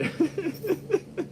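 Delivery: a sudden, high real laugh; high burst of laughter. Real, not acted. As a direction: break into laughter at your own joke. Laughing at own joke.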